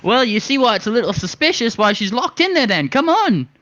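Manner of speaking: a flighty little rascal egging them on; high excited bird voice. Acted. Coaxing the drunk sailors toward the warehouse, high and eager. Player voicing his bird character.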